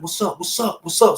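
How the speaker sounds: rising, urgent repeated question; getting amped. Retold or imitated. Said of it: "So I'm getting amped".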